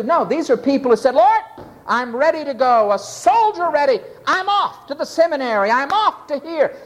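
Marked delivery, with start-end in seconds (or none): 1.1-6.7 acted enthusiasm, pitched up and brisk; eager volunteer. A volunteer telling the Lord he's ready, enthusiastic. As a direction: play a keen recruit, voice lifted. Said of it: "was enthusiastic".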